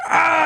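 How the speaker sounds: high mock scream of horror; long high comic "aah"; imitated shriek of a wounded man; imitated horrified scream. Man discovers his eye is out.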